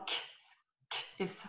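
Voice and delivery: K as a bare unvoiced click of the consonant, no vowel; soft, separated demo of the sounds in "K, K, S"; isolated consonant sounds. Shown as a demonstration. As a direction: sound out "K" on its own, very softly, as heard in taxi. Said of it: "on n'entend pas X. On entend".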